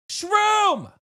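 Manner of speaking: high, loud call of the donor's name; excited call. Real, not acted. Streamer thrilled at a donation, calling out high and loud. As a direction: shout his name across the room in delight.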